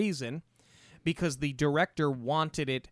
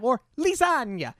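"lasagna" with a higher, hammed-up Italian lilt; mock Italian lasagna; 8 semitones higher, 3 dB louder than usual. Mocking how Julia says "lasagna", higher and hammed-up. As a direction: poke fun at "lasagna" in a put-on Italian way. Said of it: "when she does say things like lasagna".

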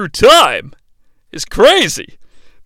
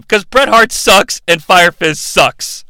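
ordinary talk, nothing marked

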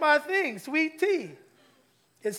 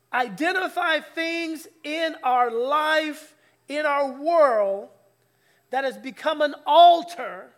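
very slow, drawn out, louder and louder; slow preaching emphasis; 3 semitones higher, 6 dB louder, slower than usual. Acted. Pastor urging church to name idols, slow rising emphasis. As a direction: lean on each word like a preacher.

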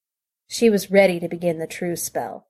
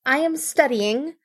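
put-on eager young voice; 7 semitones higher than usual. Apprentice answers master.

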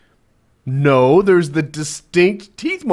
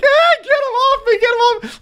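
much higher, louder mock panic; panicked scream; 4 dB louder than usual. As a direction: shriek for help while being bitten. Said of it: "the guy starts like".

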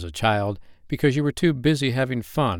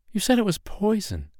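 much higher, alarmed protest; alarmed objection; 13 semitones higher, 3 dB louder than usual. Acted. Woman protesting that the snake is poison, alarmed.

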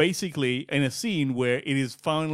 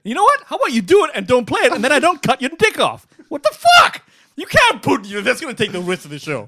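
mock threat; 9 semitones higher, 6 dB louder than usual.

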